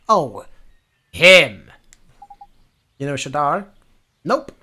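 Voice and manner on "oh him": sudden loud realisation. Acted. Sudden recognition.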